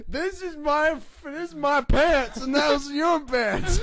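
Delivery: high, loud, slow dopey voice; dazed Mark Henry just slapped awake, slow and dopey; dim brute. Retold or imitated. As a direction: play him slow and dim-witted. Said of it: "And he's like".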